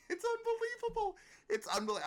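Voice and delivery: very high, loud laughing unbelievable; laughing exasperation. Real, not acted. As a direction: laugh it out, fed up.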